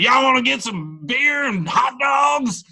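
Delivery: high, slow, shouted Southern y'all; mock Southern drawl. After a few beers the Virginian drops the act, hammed-up Southern shout. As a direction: ham up a tipsy Southern good old boy. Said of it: "after a couple beers we're like".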